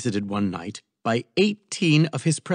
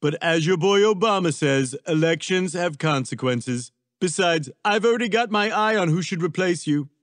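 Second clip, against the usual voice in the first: higher, lightly voiced Trump; glib Trump; 6 semitones higher than usual. A narrator's reading. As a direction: brush her off glibly.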